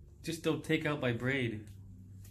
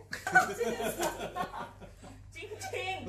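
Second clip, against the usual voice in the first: high laugh. Real, not acted. Laughing at both ears waxed.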